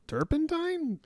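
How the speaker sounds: Turpentine? high and puzzled, falling; mock confusion. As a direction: misread it as Turpentine? in surprise. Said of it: "But it's not turpentine".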